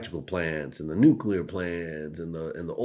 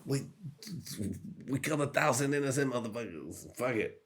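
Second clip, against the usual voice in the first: higher anguished mock voice; 8 semitones higher than usual. Comedian imitating himself reading the news, higher anguished voice. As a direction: agonize over the news, half-joking. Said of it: "be like".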